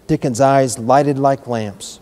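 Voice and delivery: plain reading voice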